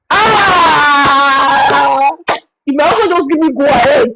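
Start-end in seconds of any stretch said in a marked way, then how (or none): 0.1-2.1 sudden loud cry of eagerness; excited shout. Real, not acted. Wife unleashed by husband's go-ahead, loud excited "Ah!". Eager to fight once allowed.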